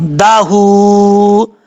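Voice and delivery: दाहू slowly with a drawn-out heavy h. Shown as a demonstration. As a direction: stretch दाहू with a full, heavy h. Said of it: "हा को भारी sound के साथ पढ़ा जाएगा".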